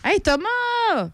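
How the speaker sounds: high drawn-out call across the room. Real, not acted. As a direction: call him from afar. Calling out to Thomas.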